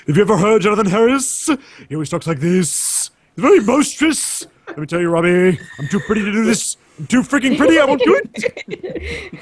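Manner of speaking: put-on theatrical, boisterous old actor voice; hammy, boisterous Dracula; Jonathan Harris voice. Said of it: "this Dracula character".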